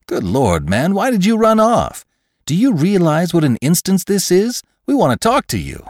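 high, exclaiming, breathless-with-wonder delivery; excited scientist McClure. Acted. Scientist stops a runaway he longs to study, excited and high. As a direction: exclaim it in eager amazement.

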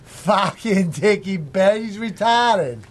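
slow, broad Boston accent. Shown as a demonstration. Asked about a singer, keeps up drawn-out Boston accent. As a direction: drag it out like a Bostonian. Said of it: "do a Boston accent again".

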